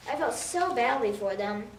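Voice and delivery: ordinary delivery, nothing marked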